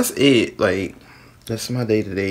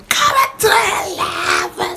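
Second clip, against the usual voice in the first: shouted high intro greeting; big hype shout to open; loud intro; 5 dB louder, slower than usual. Real, not acted. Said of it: "intros be sounding like that".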